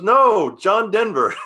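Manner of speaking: high, loud, emphatic; mock exasperated correction; insistent correction. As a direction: set them straight firmly. Driver correcting the passengers.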